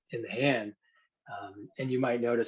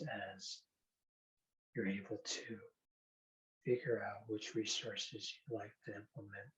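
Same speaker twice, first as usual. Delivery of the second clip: hypnosis induction; 6 dB quieter than usual. Acted. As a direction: guide them gently into trance.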